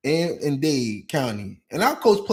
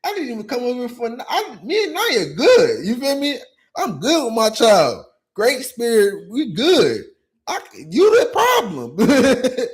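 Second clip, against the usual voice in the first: high, heated retort; raised, indignant comeback; 6 semitones higher, 3 dB louder than usual. Retold or imitated. Father retelling his reply to the mother, indignant. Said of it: "I'm like".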